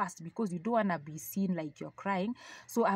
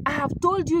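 high, louder mock scolding; rough soldier; 8 semitones higher, 7 dB louder than usual. Soldier ordering her back to the wall. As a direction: snap it like an impatient guard. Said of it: "And he says".

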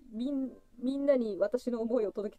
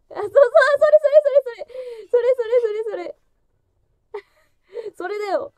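much higher, louder burst of sudden recognition; excited recognition; 9 semitones higher, 9 dB louder than usual. Real, not acted. Finally recognizing the word she was searching for, excited shout. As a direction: blurt it out the moment you spot it.

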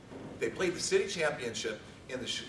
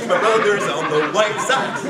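louder, laughing through the cheer; 5 semitones higher, 12 dB louder than usual. Retold or imitated. Sibling repeating the news, laughing cheer. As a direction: let the joy spill into laughter.

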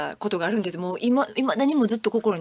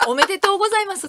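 laughing mock congratulations; 10 semitones higher, 4 dB louder, much faster than usual.

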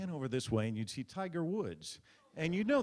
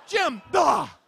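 higher, loud shouted name; shouted cue; 13 dB louder than usual. Retold or imitated. Comic imagines reporter shouting to anchor, loud high. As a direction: bark the name then gasp. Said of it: "Why can't he go".

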